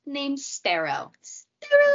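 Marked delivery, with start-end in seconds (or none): 1.2-1.9 high squeal of delight. Acted. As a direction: squeal the name with glee. Delighted cry at the cat's name.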